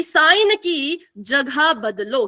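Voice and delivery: slow and deliberate, word by word; slow teaching rule. Shown as a demonstration. Teacher slowly drilling the cosine rule for the class. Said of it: "क्या करो?".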